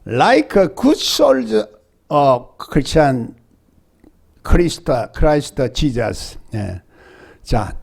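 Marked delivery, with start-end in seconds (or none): 0.1-6.4 very slow, word by word English; slow English reading. Shown as a demonstration. Preacher reading English verse to congregation, slow and deliberate. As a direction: spell out each English word slowly for the congregation. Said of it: "영어에 보면 조금 뉘앙스가 조금 달라".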